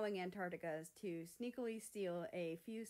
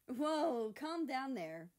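higher, louder teasing voice; giggling; 6 semitones higher, 7 dB louder, slower than usual. Acted. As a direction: laugh at him playfully. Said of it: "Mexico giggled".

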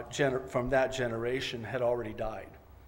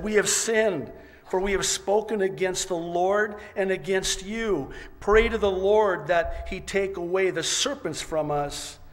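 raised, louder acted confession; urgent pleading; 6 semitones higher, 5 dB louder than usual. People confess to Moses after the serpents, urgent pleading. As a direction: plead it out high and forcefully, as if reading scripture aloud.

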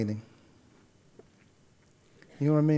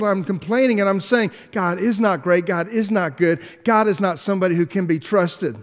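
preaching; 5 semitones higher, 5 dB louder than usual. Acted. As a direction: drive each line home to the congregation. Preaches the point.

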